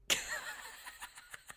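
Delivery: very high, wheezy giggle; helpless giggle. Retold or imitated. Patient unable to hold breath in scanner, helpless giggling. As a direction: let out a soft, high fit of giggles.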